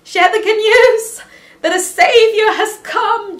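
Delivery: high, loud, slow, preacher-style announcement; booming mock sermon opener; mock proclamation. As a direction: proclaim it like a joyful herald, drawn out.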